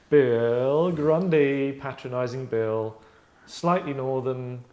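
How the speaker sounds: loud, stretched-out cue for the take; slow, projected director's call; drawn-out announcement. Real, not acted. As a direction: announce it slowly, lingering on each word. Calling the next take's character.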